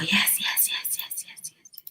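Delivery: whispered yes that fades to nothing; fading whisper. Real, not acted. Coach whispering yes to the class, fading away. Cheering on a correct answer.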